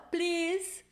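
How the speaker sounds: high, pleading retold cry for help; imitated helpless "please"; mock helpless plea. Acts out her helpless plea at airport.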